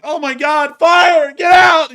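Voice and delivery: high, full-voiced alarm shout; yelling in panic. Yelling fire in a crowded theater, mock panicked shout. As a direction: scream a fire warning to clear the room. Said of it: "if you start yelling".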